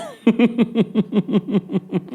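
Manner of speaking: long, high giggles; real high-pitched laughter; high giggling laugh. Real, not acted. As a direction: laugh helplessly at your own joke. Laughs at own impression.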